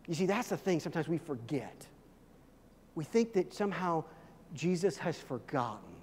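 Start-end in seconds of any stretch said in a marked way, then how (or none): none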